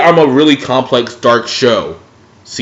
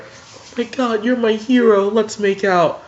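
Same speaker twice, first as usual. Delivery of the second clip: put-on much higher, breathy-free gushing girl voice; mock swooning Starfire; 8 semitones higher, 4 dB quieter than usual. Starfire gushing over Robin, high mock swoon. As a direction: coo it like a starstruck heroine.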